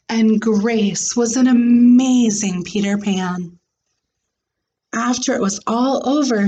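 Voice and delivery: clear, expressive read-aloud voice; story narration. A narrator's reading. Teacher reading a storybook aloud to her class, expressive. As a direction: read the picture book to the class.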